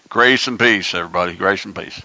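lazy dismissive church greeting; mock casual greeting. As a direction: toss it off like a casual hello. Mocking a casual throwaway greeting.

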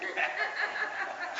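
high, quiet, breathy laugh; soft laugh at one's own joke. Real, not acted. Preacher laughs after mimicking the martyr, quiet chuckle.

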